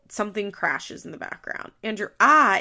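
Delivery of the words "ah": mild mock yelp. Reading Andrew's cry of pain.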